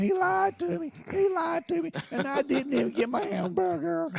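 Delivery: high, mock-crying wail; hammed-up sob of betrayal. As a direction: imitate her squalling with a whine. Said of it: "squalling and screaming".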